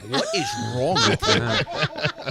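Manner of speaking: squeaky stretched-out disbelief; high, slow disbelief. Retold or imitated. Comic telling his cousin off, in a high slow mock-horrified voice. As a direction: drag it out high in mock horror. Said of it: "I'm like".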